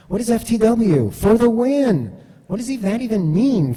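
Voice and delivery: high, loud baffled voice; imitating his own confusion; mock bewildered reaction to coworker slang. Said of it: "And I'm like".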